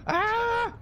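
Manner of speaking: sudden high mock scream; panicked scream. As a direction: yelp like you just got ambushed. Acting out a panicked retreat.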